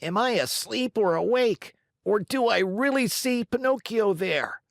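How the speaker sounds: high, astonished marionette voice; disbelieving, nearly shouting; wild amazed cry. Acted. Harlequin spots Pinocchio in the audience, amazed cry. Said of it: "yelling wildly at the same time".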